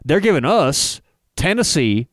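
normal talking voice

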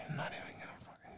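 giving up in a whisper; soft, whispered self-exasperation; whispered aside. Real, not acted. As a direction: whisper a fed-up aside to yourself. Gives up on correcting his slip.

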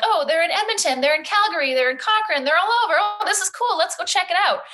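high, bubbly and gushing; excited discovery. Retold or imitated. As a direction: gush like you just found something cool online. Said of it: "someone goes online and they're like".

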